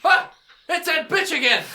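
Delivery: high, loud cry of outrage; outraged townsfolk. Acted. Townsfolk spot a known troublemaker, outraged shout. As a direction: shout it as an angry mob. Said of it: "And everyone's like".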